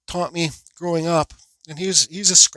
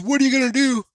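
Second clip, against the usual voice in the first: high mimicked goading, normal volume; retold defiant taunt; 9 semitones higher than usual. Other driver's taunt recalled afterwards, retold defiant taunt. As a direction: mimic his taunt in a raised pitch.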